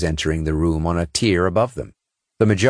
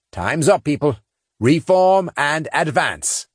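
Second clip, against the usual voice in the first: raised, slow command voice; commanding order; 9 semitones higher than usual. A narrator's reading. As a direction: call the squad to move out. Saint commands over comm.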